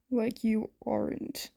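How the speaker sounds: deadpan retort, understated. A narrator's reading. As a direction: say it dry and quiet.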